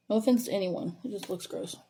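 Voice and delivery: ordinary talk, nothing marked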